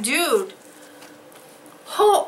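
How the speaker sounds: normal talking voice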